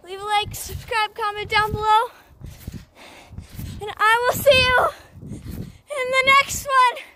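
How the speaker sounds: high loud outdoor yelling; loud excited shouting. Real, not acted. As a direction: shout over the bouncing with excitement.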